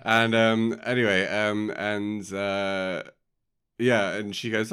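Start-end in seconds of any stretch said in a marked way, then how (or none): none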